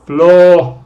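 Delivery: "Floor" called out loud and clear; loud model word. Shown as a demonstration. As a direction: belt out "Floor" like a teacher. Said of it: "es el mismo sonido".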